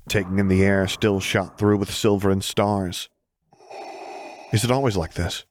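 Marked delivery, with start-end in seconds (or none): none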